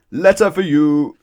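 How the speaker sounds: loud, high, slow announcement; loud call. Acted. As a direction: boom it at the door. Postman shouting.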